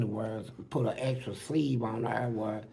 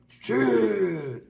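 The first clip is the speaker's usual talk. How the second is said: higher, mock hollered curse; a raised, half-performed cry of frustration; retold holler; 8 semitones higher than usual. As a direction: imitate him cursing out, pitched up. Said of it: "Then he'll holler".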